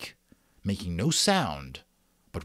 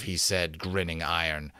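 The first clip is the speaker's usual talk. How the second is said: plain reading voice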